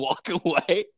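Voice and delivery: ordinary talk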